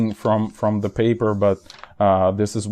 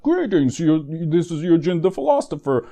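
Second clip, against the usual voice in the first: greeting pitched much higher; trailer-style greeting; 7 semitones higher than usual. Acted. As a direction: lift the whole greeting way up. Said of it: "So this was the trailer for this video".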